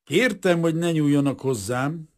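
mildly reproachful, courteous character; smiling politeness. A narrator's reading. Kazimír answering the detective, with a pleasant smile. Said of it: "egy kedves kis mosollyal".

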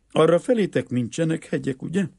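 high-pitched, lightly acted curiosity; curious question. A narrator's reading. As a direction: ask it high and loud, with innocent interest. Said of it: "kérdezte meg kíváncsian".

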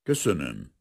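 understated frosty thanks at normal volume; icy. A narrator's reading. Officer coldly thanks the professor after his confession, icy. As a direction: cut off the speech with cool restraint.

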